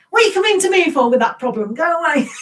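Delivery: mock shouting. Acting out a funny English saying, mock shouting. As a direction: rattle it off high, as a playful telling-off. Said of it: "It's like, we're shouting".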